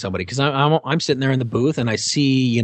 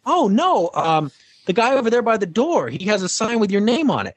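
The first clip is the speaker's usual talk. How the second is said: put-on lightly higher young fan voice; fan explaining; 9 semitones higher than usual. Fan points out the driver's sign, lightly higher voice. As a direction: explain it matter-of-factly like the fan. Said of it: "And she said".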